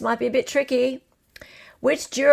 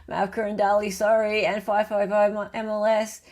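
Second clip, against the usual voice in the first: normal talking voice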